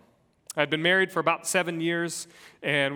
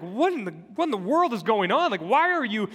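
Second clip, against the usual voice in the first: put-on higher and louder exasperated shout at the kids; frustrated outburst; 7 semitones higher, 6 dB louder than usual. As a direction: let the frustration burst out at the fighting children. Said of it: "I begin to get really frustrated".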